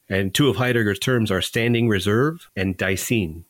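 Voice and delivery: ordinary talk, nothing marked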